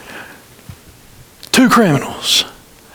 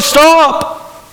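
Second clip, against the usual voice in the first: high shouted STOP; hollered stop; 8 semitones higher than usual. Acted. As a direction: yell it to halt the whip. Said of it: "the king hollered".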